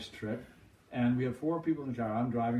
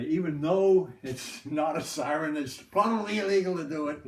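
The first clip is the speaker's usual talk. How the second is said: lightly acted cop, raised and louder; mock warning; 4 semitones higher, 6 dB louder than usual. State cop joking to kids about the siren, mock warning.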